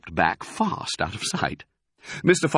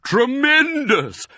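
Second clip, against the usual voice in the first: high, slow, loud exclamation; delighted shout; 6 dB louder, slower than usual. A narrator's reading. Badger delights in the cider, booming shout. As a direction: shout it, stretching the middle. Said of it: "shouted Badger".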